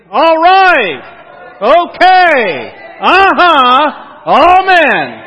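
full-voice, high revival shout; enthusiastic shout. Shown as a demonstration. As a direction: shout it out to the rafters. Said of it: "do it with some enthusiasm".

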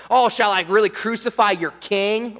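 mock-incredulous, sarcastic question; smart aleck. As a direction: get under their skin with feigned surprise. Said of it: "being a smart aleck".

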